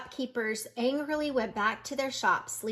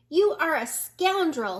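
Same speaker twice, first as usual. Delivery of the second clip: scolding; 5 semitones higher, 6 dB louder than usual. A narrator's reading. Angry pig scolds wolf.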